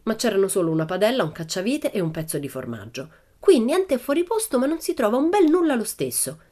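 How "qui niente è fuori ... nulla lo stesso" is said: put-on high, louder girl's voice, cross; grumpy exclamation. A narrator's reading. Pippi grumbling while searching for her hat, high and cross. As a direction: Pippi huffing at the mess. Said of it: "esclamò Pippi di malumore".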